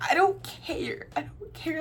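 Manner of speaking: high-pitched, softly mock-whiny protest; exasperated retort. Answering friend's breathing correction, exasperated.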